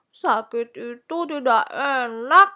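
slow, loud, put-on groaning child; complaining.

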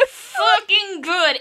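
choked-up fangirl praise; emotional gush. Real, not acted. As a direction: drag it out, overwhelmed by how good it is. Overcome praising the ending.

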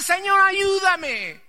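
high, loud, drawn-out plea; clamoring to God. Retold or imitated. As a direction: call out to the Lord in desperation. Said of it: "a clamarle". (clamor alto y desesperado)

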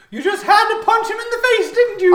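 high, loud exasperated cartoon voice; Garl's voice. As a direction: scold him in a high squawk. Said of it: "you hear Garl say".

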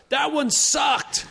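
high, drawn-out heckling shout; imitated heckler's holler. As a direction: yell it slowly from the back of the club. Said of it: "this guy in the back goes".

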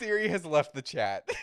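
said through laughter; laughing. Real, not acted. Joking to cohost about Siri, laughing. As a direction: laugh out the words.

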